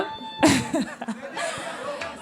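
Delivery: long airy laugh. Real, not acted. Judge amused by the duo's new nickname, long laugh.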